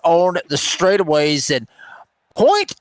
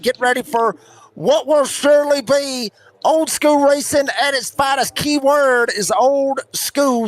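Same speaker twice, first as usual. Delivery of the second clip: race announcer; 8 semitones higher than usual. Real, not acted. Announcer welcoming fans to Rockingham Speedway, big show-opening call. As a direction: announce it big, stretching the key words.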